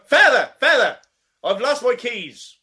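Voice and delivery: loud, high, bad Irish calling out "Feather!"; a poor Irish fella chasing a priest; bad Irish accent. Irish man chasing a priest, badly faked accent. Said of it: "I can't do accents".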